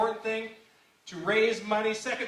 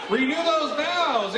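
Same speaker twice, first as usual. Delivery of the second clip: shout; 6 semitones higher, 5 dB louder, slower than usual. Acted. Hyping the crowd.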